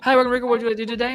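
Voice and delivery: service greeting. Retold or imitated. Retold customer greeting.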